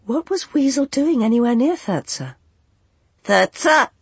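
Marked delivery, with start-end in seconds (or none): 3.2-3.9 high, loud squawk; squawking bird. A narrator's reading. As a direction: call it out like a seabird. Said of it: "said the angel".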